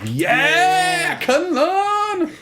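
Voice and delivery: high, loud fan whoop; excited cheer. Retold or imitated. Host recalling cheering at the trailer, high loud whoop. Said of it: "I was like".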